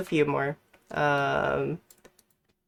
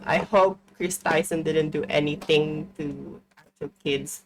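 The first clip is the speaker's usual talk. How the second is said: ordinary delivery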